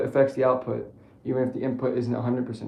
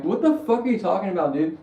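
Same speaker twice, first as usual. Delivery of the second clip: incredulous; 6 semitones higher, 6 dB louder than usual. Retold or imitated. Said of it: "I'm like".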